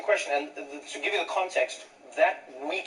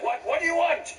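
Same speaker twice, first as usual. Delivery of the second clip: very high, incredulous cop; mock disbelief, pitched way up; baffled policeman; 14 semitones higher than usual. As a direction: imitate an officer demanding a repeat. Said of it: "the cop is like".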